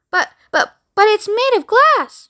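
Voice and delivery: high stammering alarm; old man sputtering in fright; panicked protest. Acted. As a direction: Grandpa Joe panicking. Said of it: "shouted Grandpa Joe".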